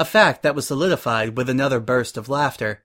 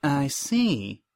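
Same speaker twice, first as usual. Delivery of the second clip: softer reply through a pasted-on smile; forced smile; 4 dB quieter than usual. A narrator's reading. Suri hides her annoyance at Prim, still smiling. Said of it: "Suri said, still smiling".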